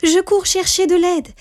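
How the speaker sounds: put-on very high-pitched little-girl exclamation; urgent exclamation. A narrator's reading. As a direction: call it out high and eager to help. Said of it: "s'exclama Rose Rouge".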